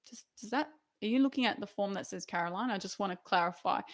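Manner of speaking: very soft, hesitant checking; tentative disbelief. Acted. Junior doctor checking the feedback form is hers, timidly quiet. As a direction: play timid, hushed doubt. Acts doubting the good feedback.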